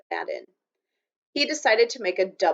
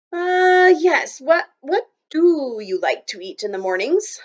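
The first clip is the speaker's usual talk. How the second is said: flustered; 7 semitones higher, 6 dB louder than usual. A narrator's reading. Flustered boy answering.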